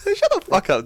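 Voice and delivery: high, laughing retort; repeated mock retort. Repeating his comeback, high and amused. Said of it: "repeat of the retort".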